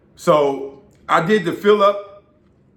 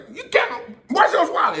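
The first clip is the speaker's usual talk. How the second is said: excited fan; 13 semitones higher than usual. Retold or imitated. Fan recognizing him.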